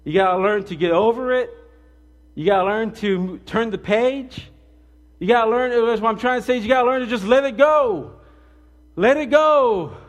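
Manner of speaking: preaching that climbs from normal to full-force, high and loud; building sermon climax. Acted. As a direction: drive it home, rising with each repeat to a shout.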